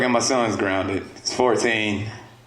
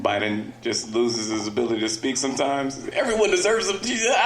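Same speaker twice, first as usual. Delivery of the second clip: ordinary talk, nothing marked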